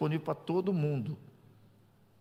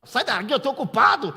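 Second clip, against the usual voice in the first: loud, higher mock snap; annoyed brush-off; 11 semitones higher, 8 dB louder than usual. Wife playfully shooing husband away, loud mock snap. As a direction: shoo her away, busy and irritated. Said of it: "ela fica brava".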